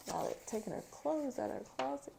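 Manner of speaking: ordinary delivery, nothing marked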